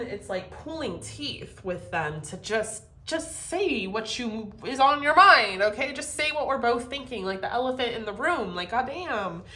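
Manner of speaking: ordinary delivery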